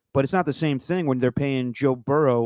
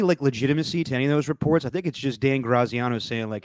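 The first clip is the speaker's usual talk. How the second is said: normal talking voice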